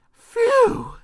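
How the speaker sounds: high-pitched loud phew; relieved phew. A narrator's reading. Mister Jelly finding only a twig, hugely relieved.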